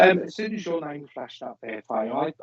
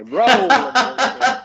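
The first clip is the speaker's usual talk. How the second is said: game show call; 12 semitones higher, 5 dB louder, slower than usual. Shown as a demonstration. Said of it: "I say".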